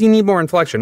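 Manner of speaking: high squeaky imitating director; high mock voice. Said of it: "And so she goes".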